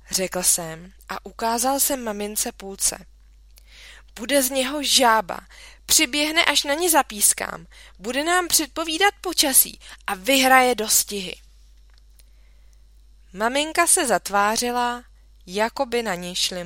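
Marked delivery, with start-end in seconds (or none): 4.2-11.4 put-on eager child. A narrator's reading. Boy eagerly defends tadpole.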